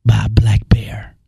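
mock-dramatic announcer. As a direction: intone it deep and deliberate, like a trailer.

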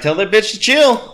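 high, loud movie-standoff command; mock yelled order. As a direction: act out yelling across a standoff. Acting out tense standoff order.

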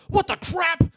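high, loud startled exclamation; startled cry. Retold or imitated. Imitating the cop startled by the speeding car.

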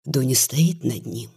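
plain reading voice

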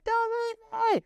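very high imitated wail; re-enacted desperate high shriek; screaming. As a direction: scream after someone who is leaving you. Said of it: "She's screaming in the lobby".

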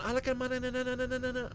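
very high, trailing fake-Spanish babble; hammed-up imitation of a woman gibbering Spanish; mock Spanish. Mimicking a lover's Spanish, high mock babble. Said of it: "She was going".

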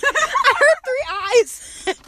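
high, loud, slow laughing; laughing at the group's answers. Real, not acted. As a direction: crack up while saying it.

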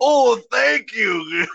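high, drawn-out mock thanks; hammed-up gratitude. Mover handed muscle relaxants, hammed-up slow, high gratitude. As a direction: gush slowly in a put-on high voice. Acts movers thrilled to get pills.

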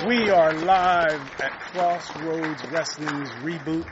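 loud, slow announcer opening; show host opener. Acted. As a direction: open the show like a ring announcer. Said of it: "host opens live show".

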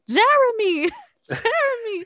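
very high, loud name call; retold calling out. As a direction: imitate someone hollering after a friend, laughing between calls. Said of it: "we hear someone go".

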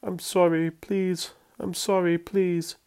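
high, whimpering apology; whimpering plea. A narrator's reading. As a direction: beg in a small frightened whimper. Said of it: "he started to whimper".

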